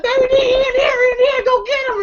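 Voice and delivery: very high-pitched mock holler; calling out. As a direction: imitate someone yelling for backup. Acted calling for backup.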